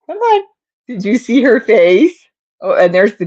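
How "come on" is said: high pet-talk call. Real, not acted. Calling the cat's attention.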